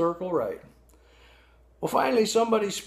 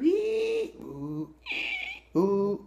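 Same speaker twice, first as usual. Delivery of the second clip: harmonica-like wheeze; much slower than usual. Shown as a demonstration. Narrator imitating Baby Blue's harmonica voice, high squeaky wheezes. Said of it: "kinda like that".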